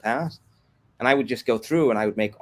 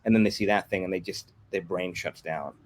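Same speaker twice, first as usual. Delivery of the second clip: ordinary delivery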